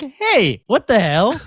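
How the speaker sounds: high-pitched, playfully offended retort; mock indignation. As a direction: act affronted, half-joking. Retelling his offended comeback to stylist.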